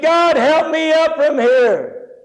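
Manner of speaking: high, drawn-out desperate holler; calling for help. Retold or imitated. Dangling man begs God to pull him up, hollering. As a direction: cry up to heaven, stretching the words.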